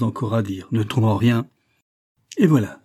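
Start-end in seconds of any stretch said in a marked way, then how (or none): 2.4-2.8 loud, high burst of triumph; proud loud exclamation; exclaiming. A narrator's reading. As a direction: cry it out, pleased with himself. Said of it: "s'écria-t-il".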